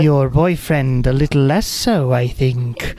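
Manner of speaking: slow, eerie ladylike voice; creepy lady voice. Acted. Eerie glade voice tells her about the boyfriend, creepy lady voice. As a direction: linger creepily over the words. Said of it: "Was a pretty creepy lady voice".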